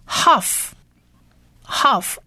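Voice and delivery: half said slowly as "hahf", twice with a pause; half as Hebrew letter name. Shown as a demonstration. Teacher introduces the second letter, slow "hahf" demo. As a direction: model half slowly, ending in f. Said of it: "第二个字母呢是".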